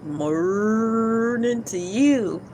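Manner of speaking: slow, stretched good morning; greeting the viewers again, slow and stretched; drawn-out sing-song greeting. Shown as a demonstration. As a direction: draw out each word like a sleepy tune. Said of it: "or should I say good".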